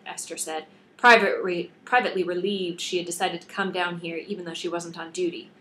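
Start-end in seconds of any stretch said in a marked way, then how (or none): none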